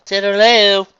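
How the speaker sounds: high, loud sing-out; echoed farewell. A narrator's reading. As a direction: call it back brightly and loud. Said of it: "they both echoed once more".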